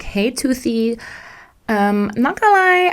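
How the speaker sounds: high, sugary asking voice; mock sweet plea. Sweet-talking message to boss.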